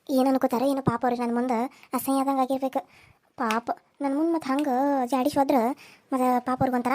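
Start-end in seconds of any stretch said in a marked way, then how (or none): none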